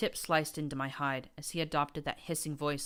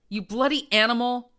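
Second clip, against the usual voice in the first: higher, loud furious scream; 8 semitones higher, 12 dB louder than usual. A narrator's reading. Rampage screaming at the ghoul prince, furious. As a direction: scream it as you charge. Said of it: "screamed Rampage".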